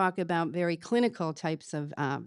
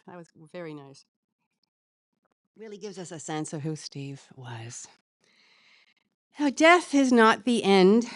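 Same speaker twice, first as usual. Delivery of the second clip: ordinary delivery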